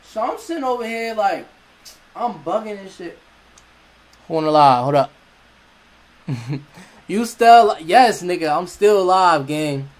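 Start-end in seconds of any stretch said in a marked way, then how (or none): 4.3-9.9 much louder, hyped, half laughing; loud excited outburst. Real, not acted. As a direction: blast it out, fired up. Excited loud outburst mid-story.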